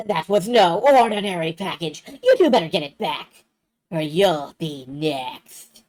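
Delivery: loud cartoon villain threat, slowing at the end; hammed-up villain menace, stretch out the last words; Cobra Commander. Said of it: "It's basically just Cobra Commander".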